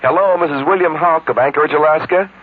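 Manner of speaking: upbeat announcer greeting; chirpy promo call; radio caller voice. Acted.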